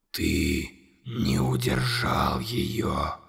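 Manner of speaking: very low, slow, whispered accusation; whispered monster. A narrator's reading. Monster whispering accusation to Conor. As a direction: hiss the accusation. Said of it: "настаивало чудовище".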